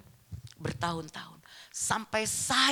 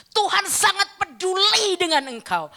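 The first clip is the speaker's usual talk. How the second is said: loud, high proclamation with stretched key words; fervent preaching; 10 semitones higher, 8 dB louder than usual. Real, not acted. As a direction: proclaim the assurance to the congregation. Preaches assurance at full force. (seruan khotbah yang lantang dan berapi-api)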